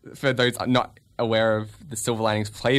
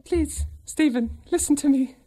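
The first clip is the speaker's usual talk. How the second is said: man doing a woman's voice; 13 semitones higher than usual. Acted. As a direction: coax him high and sweet. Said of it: "Where's your lady voice?".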